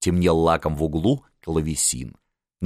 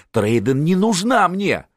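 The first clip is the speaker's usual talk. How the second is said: high, drawn-out plaintive refusal; tearful protest; 11 semitones higher, 4 dB louder than usual. A narrator's reading. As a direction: wail it a little, slowly. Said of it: "заплакал сын". (плаксиво, высоко, протяжно)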